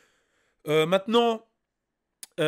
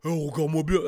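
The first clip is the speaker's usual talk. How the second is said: lower, heavy tough-guy voice; deep rapper voice; 4 semitones lower than usual. Acted. Imitating the rapper confronting a guy at a robbery, deep voice. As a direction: drop it low like a menacing rapper. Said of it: "Et lui, il va arriver".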